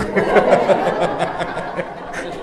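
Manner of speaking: airy unvoiced laughter; breathless amused laugh; breathy laugh. Real, not acted. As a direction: laugh out at the joke. Laughs at pantun joke.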